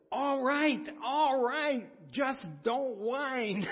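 high, loud, slow exasperated judge; impatient judge. Retold or imitated. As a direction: cut him off, fed up. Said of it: "and she said".

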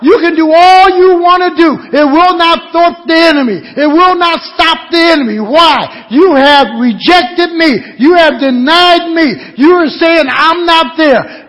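high, loud preaching at full force; thundering rebuke. Acted. Preacher voicing God to those who rejected him, thundering. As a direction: hammer out the rebuke.

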